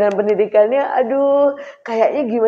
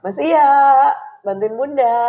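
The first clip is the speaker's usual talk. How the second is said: calling her son; 7 semitones higher, 3 dB louder than usual. Shown as a demonstration. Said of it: "manggil anak gitu, minta tolong".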